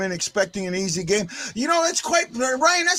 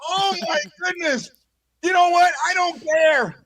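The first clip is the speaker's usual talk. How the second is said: high, giddy celebration; real over-excited cheering; pumped up; 8 semitones higher than usual. Real, not acted. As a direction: burst out high, carried away by the moment. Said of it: "pumped up".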